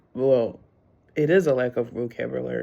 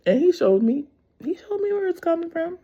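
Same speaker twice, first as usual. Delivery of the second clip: excited testimony; 4 dB louder than usual. Real, not acted. Excited testimony about God.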